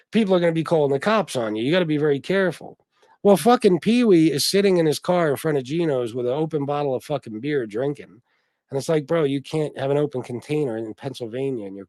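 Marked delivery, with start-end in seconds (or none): none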